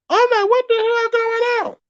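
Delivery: very high, squeaky sped-up voice; chopper voice. Pretend. Streamer mocking other creators, in a squeaky chopper voice. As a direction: squeak it out like a chipmunk. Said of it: "in my chopper voice".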